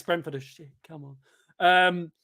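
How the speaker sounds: ordinary talk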